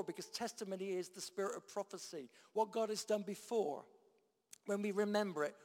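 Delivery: ordinary talk, nothing marked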